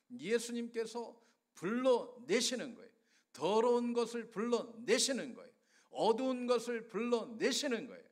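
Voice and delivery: high, driving preacher's refrain; impassioned sermon cadence, repeated with rising force; fervent preaching. Real, not acted. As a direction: hammer each phrase home to the congregation. Preacher builds a chanting refrain.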